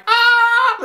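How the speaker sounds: high-pitched fan squeal; reenacted shriek of delight; excited scream.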